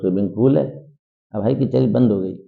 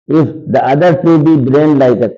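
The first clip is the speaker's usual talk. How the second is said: slow, louder recital of the line; slow dramatic reading; 3 semitones higher, 4 dB louder, much slower than usual. Shown as a demonstration. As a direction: read it out like a stage line, slowly. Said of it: "teacher reads text slowly". (धीमा नाटकीय पाठ)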